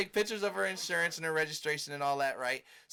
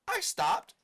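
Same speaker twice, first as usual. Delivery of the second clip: higher, louder mock protest; mock indignant woman; 10 semitones higher, 5 dB louder than usual. To him, the driver insists she stopped, high mock voice.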